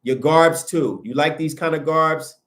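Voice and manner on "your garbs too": usual speaking voice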